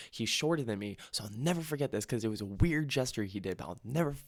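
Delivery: ordinary talk, nothing marked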